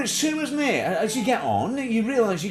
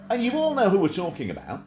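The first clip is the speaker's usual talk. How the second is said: usual speaking voice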